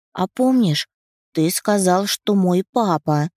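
put-on high, eager child voice; little girl voice. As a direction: ask like a curious little girl. Narrator voices little daughter.

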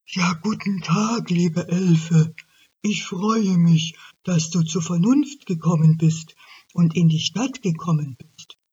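smug banker elk. Acted. Elk greets elf, smug.

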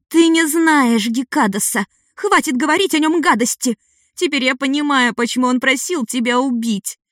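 put-on high, upset girl shouting back; a hurt child lashing out; furious young girl. A narrator's reading. Ada furious at Yan.

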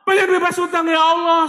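put-on high, loud childish wail, begging; a child's high wailing plea to God; whining like a crying child. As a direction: beg like a kid crying for a toy. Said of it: "Seperti anak kecil minta mainan, nangis".

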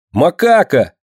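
high, eager exclamation; excited call. A narrator's reading. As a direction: burst in calling her nickname. Лёнька влетает, зовёт Динку. (радостный высокий возглас)

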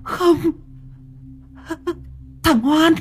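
gasping, high-pitched shock; horrified disbelief. Acted. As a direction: recoil in horror, panting between words. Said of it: "Cô Hoa kinh hãi".

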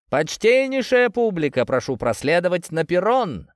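high, loud amplified showman call; booming circus ringmaster. Acted. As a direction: announce it through a loudspeaker. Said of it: "многократно усиленный голос сеньора".

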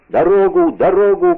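high, loud group demand; shouting. A narrator's reading. Gang leader's comrades demanding passage, shouting. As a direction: keep calling to clear the way. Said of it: "продолжали кричать".

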